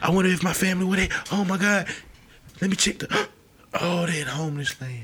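high, fast gasping discovery; excited discovery. Pretend. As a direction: gasp and hurry, thrilled to find family. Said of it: "You might".

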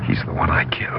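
whispered admission of killing; guilty whisper; hushed confession. Acted. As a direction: breathe it so no one overhears.

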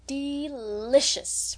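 slow, drawn-out relish, lips smacking; savoring. A narrator's reading. Rose teasing Lily about the meeting, savoring. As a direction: savour the word like a treat. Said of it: "smacking her lips as at the recollection".

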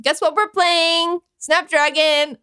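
high, slow, sing-song invitation; a lightly voiced host cheerfully announcing Snapdragon; mock eager party host. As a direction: sell the dreaded game with fake glee.

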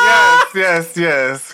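high, loud repeated yeses; gleeful shouted approval; excited recognition. Real, not acted. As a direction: cry out in delighted agreement.

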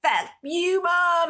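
high, bratty mock teenager; teen shouting back at mom, high mock sneer; mock teen retort. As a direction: snap back like a sulky teen, pitched high. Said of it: "And they're like".

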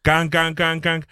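ordinary delivery

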